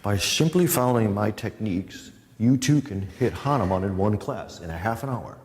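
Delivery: put-on announcer voice selling a miracle method; mock infomercial pitch. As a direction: pitch it like a cheesy TV ad. Mock sales pitch for his techniques.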